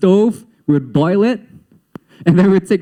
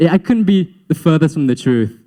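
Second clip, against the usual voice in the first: ordinary talk, nothing marked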